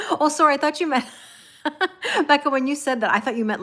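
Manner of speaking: loud, high laughing apology; laughing at her own mix-up; laughing embarrassment. Real, not acted. Laughing at misreading a viewer's comment about Nick.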